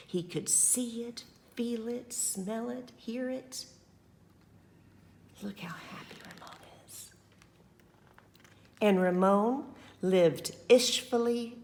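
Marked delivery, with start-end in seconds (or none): 5.4-7.1 very soft, quick whisper; whispered happy ending for the children. Acted. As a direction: hush it like a secret ending. Hushed story ending.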